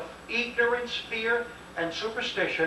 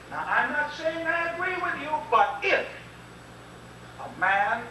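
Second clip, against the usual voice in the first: quoted general; 8 semitones higher than usual. Retold or imitated. Quoting the general.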